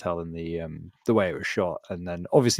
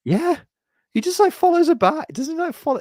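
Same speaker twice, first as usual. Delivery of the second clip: high-pitched disbelief; 4 dB louder than usual. Real, not acted. Recalling creepy scene, pitch jump.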